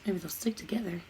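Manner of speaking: whispered aside to herself. Real, not acted. Teacher whispering while fumbling the coins. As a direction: breathe it under her breath.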